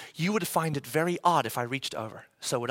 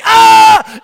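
sudden loud high yelp of pain; 19 dB louder than usual. Retold or imitated. Dad hurting his shoulder on the gate, loud yelp. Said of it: "and you're like".

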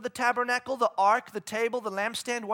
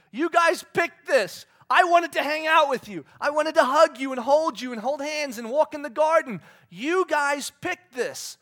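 hurt pleading God; 5 semitones higher, 7 dB louder than usual. Acted. God pleading with the people he loves, hurt and loud. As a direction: plead like a wounded parent.

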